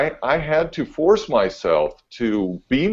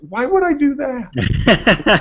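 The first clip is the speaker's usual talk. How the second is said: much higher, slow, incredulous mock voice; mock baffled coworker; 14 semitones higher, much slower than usual. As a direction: drag it out like the question is absurd. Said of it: "and he goes".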